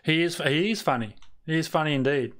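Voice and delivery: usual speaking voice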